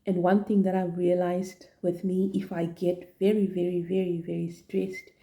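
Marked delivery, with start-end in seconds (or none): none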